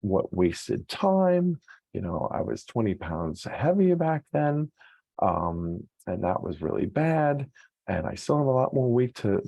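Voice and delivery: mock lament, pitched well up and dragged out; hammed-up dismay at lost years; regretful self-talk. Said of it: "you're saying things like".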